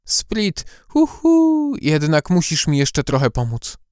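sweet, hooting, ingratiating; coaxing owl voice. Acted. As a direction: wheedle the little elf. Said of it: "przyjazny, nawet przymilny".

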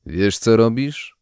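slow, lightly voiced old man; old man asking. A narrator's reading. Village elder questioning the kind woman, old man's voice. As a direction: ask slowly with a hint of an old man. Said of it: "zapytał starzec".

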